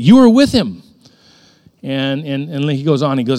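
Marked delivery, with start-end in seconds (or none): 0.0-0.8 raised, high-pitched accusing shout; loud accusation. Acted. Bystander accuses Peter of being with Jesus, loud accusation. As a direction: point the finger loudly.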